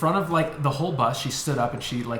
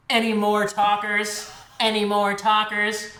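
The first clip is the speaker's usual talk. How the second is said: high, slow, monotone robot voice; robot chaperone; 8 semitones higher, slower than usual. Pretend. Robot chaperone polices the back of the bus, slow monotone. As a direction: repeat it mechanically, word by word. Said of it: "robot chaperones and be like".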